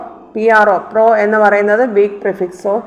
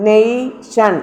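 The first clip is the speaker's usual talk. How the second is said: നെയ്, ഷൻ as two separate syllables; slower than usual. Shown as a demonstration. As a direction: isolate നെയ് before ഷൻ. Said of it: "ഷൻ അതിന് തൊട്ട് മുന്നിലുള്ളത്".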